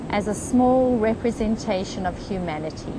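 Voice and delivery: unhurried, even meditation voice with long pauses; guided meditation. Real, not acted. Meditation leader guiding a group on the beach into comfort, calm guided meditation. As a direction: lead them gently, phrase by phrase, leaving space.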